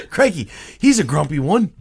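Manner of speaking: playful put-on Aussie voice; Australian accent. New York Italian playing an Australian, hammed-up accent. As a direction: do an amused mock Australian. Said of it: "a New York Italian doing an Australian accent".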